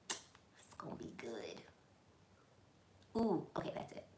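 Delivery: breathy whisper to herself. Real, not acted. Dreaming about her crab legs dinner, whispered to herself. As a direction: whisper it, savoring the thought. Savoring food order.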